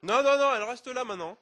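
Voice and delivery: loud, high objection; urgent protest. Real, not acted. Presenter insisting she stays in the studio, urgent protest. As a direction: cut in forcefully to stop them.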